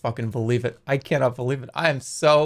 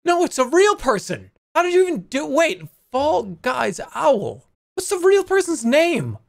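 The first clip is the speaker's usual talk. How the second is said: high-pitched, incredulous protest; indignant disbelief; 11 semitones higher than usual. Real, not acted. Protesting he was fooled.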